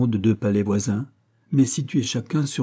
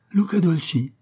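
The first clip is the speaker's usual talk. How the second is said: higher, sudden surprised cry; spotting the drunk friend, a higher startled cry; cry of surprise; 8 semitones higher, 3 dB louder than usual. A narrator's reading. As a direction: gasp the name out in surprise. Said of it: "avec un cri de surprise".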